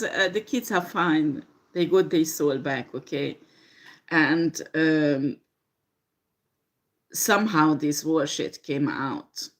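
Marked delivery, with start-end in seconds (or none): none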